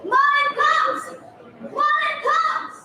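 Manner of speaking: high, far-off calling voices of the crowd; mock crowd calling across the shore; villagers crying out. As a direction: call out excitedly to the ship, pitched high. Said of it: "so they cried".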